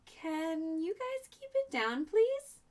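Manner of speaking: high, drawn-out polite request; polite plea. A narrator's reading. Ellie asking noisy animals to keep it down, polite plea. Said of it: "Ellie asked politely".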